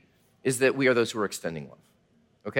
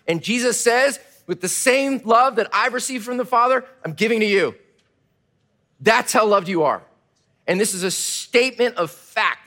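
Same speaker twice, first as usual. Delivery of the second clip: higher and louder, swelling with conviction; impassioned preaching; 7 semitones higher, 6 dB louder than usual. Acted. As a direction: lift the congregation with the truth.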